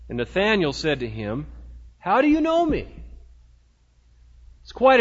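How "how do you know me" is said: higher-pitched, mildly astonished; surprised question. Acted. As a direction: ask it as if caught off guard. Acts Nathanael's startled question to Jesus.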